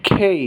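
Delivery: খেই with a puff of air, kh not k; aspirated K. Shown as a demonstration. Teacher modelling the letter K for Bengali learners, aspirated.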